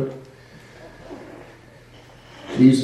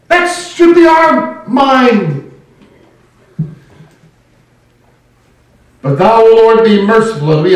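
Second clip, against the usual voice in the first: loud, high preaching at full force; 5 semitones higher, 5 dB louder than usual. Acted. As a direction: preach it out to the congregation.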